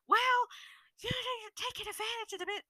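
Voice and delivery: high-pitched, rushed mock voice; mocking friend. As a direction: imitate her protest in a silly high voice. Retelling friend's argument in mock voice.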